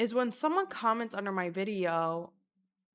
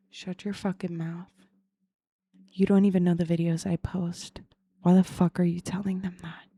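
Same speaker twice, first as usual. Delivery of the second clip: muttered retort; 4 dB louder than usual. Retold or imitated.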